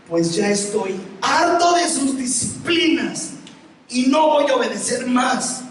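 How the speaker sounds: imitando al hijo enojado, más agudo y lento; voz de niño harto, despacio; enojo imitado. Retold or imitated. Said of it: "se me puso bravo". (hijo contestando bravo al papá)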